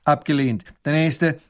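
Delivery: loud, curt refusal; dismissive. A narrator's reading. Minister rejecting the petition, curtly dismissive. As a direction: wave them off like a bothersome fly. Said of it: "lästige Fliege verscheuchen".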